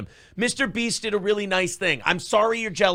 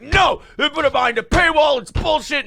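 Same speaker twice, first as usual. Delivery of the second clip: higher and louder indignant; mock indignant man; 8 semitones higher, 5 dB louder than usual. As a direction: protest it, mock outraged. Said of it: "He's like".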